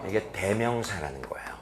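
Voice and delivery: whispered, soft reveal; a hushed classroom aside. Acted. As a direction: lean in and whisper it like a secret. Teacher reveals term.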